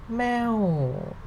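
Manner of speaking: "meow" high, then sliding down; falling tone. Shown as a demonstration. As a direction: start "meow" high and let it sink slowly. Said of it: "falling sound".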